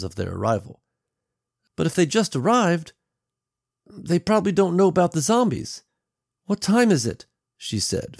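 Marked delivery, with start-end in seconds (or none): 1.7-5.8 put-on high girl's voice, concerned; worried young daughter. Daughter worries aloud in story. 6.4-7.2 put-on high girlish voice, touch of fear; edge of fear. A narrator's reading. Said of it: "fear playing around the edges of her question".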